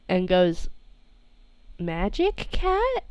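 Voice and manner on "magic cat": much higher, soft and slow puzzled ask; baffled question. Acted. Sammy stares at Genie, asking softly and slowly, baffled.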